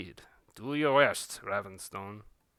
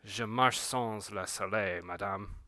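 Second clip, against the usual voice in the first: slow French line, low and quiet; spoken in French; 4 semitones lower, 5 dB quieter than usual. Acted. The daywalker answering madame, slow, quiet French. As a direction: say it in French, slowly and lower. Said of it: "in French".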